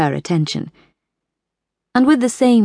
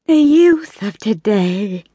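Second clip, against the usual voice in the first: slow, higher, rueful matron voice; lamenting; 4 semitones higher, slower than usual. A narrator's reading. Belle sighing to the bridge ladies, in a rueful lament. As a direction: mourn the youth of today. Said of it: "she lamented".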